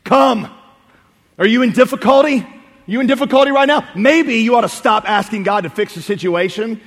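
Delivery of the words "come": louder, higher one-word order; firm command. Retold or imitated. Preacher quotes Jesus's command to Peter, emphatic order. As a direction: give it as a ringing command. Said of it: "He gave Peter another command".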